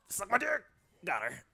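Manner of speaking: loud, high taunting shout. Real, not acted. Gamer shouting a taunt after a kill. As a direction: throw it at the enemy.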